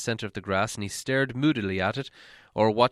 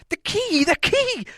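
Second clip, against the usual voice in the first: much higher, louder urgent cry; Bilbo crying out; 5 dB louder than usual. Acted. Bilbo crying to the dwarves before the light goes, frantic. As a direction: shout it as the last light fades. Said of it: "cried Bilbo".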